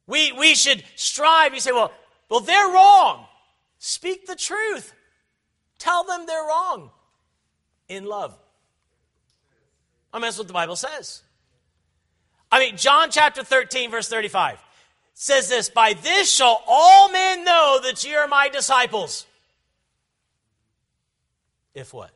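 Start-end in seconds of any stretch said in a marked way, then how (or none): 0.1-8.4 loud, high, fervent sermon delivery; raised pulpit voice, driving hard; full-force preaching. Acted. 12.5-19.3 loud, high, fervent sermon delivery; raised pulpit voice, driving hard; full-force preaching. Acted.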